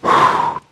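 long audible breath blown out; breathed-out puff. Retold or imitated. Preacher imitates God breathing life into Adam, airy exhale. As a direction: blow out one airy breath, no voice. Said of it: "when God breathed into Adam".

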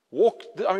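ordinary delivery

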